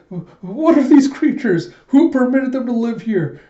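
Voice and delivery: high, loud, hammed-up panic; stammer, then cry out in high-pitched mock horror; panicked outrage. Gija panics among creepy crawlies.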